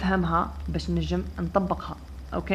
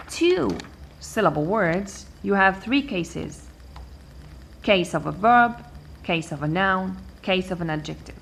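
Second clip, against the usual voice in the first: ordinary talk, nothing marked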